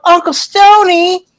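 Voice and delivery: put-on high, loud excited kid voice; squealing child's holler; shouting children. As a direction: shout it high and drawn out like little kids. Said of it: "come running out and shout".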